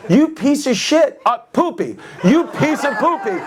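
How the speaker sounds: high-pitched indignant outburst; hammed-up, high accusation; mock outrage. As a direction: exclaim it in comic disbelief at a fraud. Acted indignant outburst at the fake Brit.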